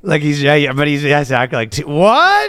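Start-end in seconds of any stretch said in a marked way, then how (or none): 1.7-2.5 high, stammered disbelief; shocked confessor. Acted. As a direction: squeak it out, mock-scandalized. Acts confessor's high reaction.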